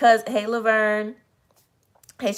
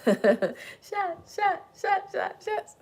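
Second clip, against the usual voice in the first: stumbling over a cracking voice; 12 semitones higher, 5 dB quieter, much slower than usual. Real, not acted. Vlogger whose voice is cracking after yesterday's shots, straining. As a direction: voice cracks up high and goes breathless. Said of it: "The shots from yesterday affected my voice".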